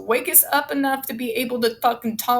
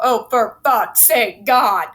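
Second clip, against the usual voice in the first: real exasperation, loud and drawn out; exasperated outburst; 6 dB louder, slower than usual. Real, not acted. Reader reacts to the claim just read aloud, exasperated. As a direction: curse loudly and slowly in disbelief.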